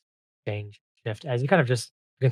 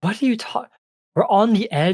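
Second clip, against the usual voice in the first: high, incredulous fairy voice; baffled ice fairy; 11 semitones higher, 6 dB louder than usual. Acted. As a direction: pitch it way up, bewildered.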